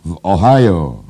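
very loud bellow, pitch swooping up; roared. A narrator's reading. Editor answering where the battle was, roaring. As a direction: roar it out in full voice. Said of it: "ryknął naczelny".

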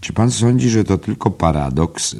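a hint of a polite old librarian; quaker librarian. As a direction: ask it like a gentle bookish quaker.

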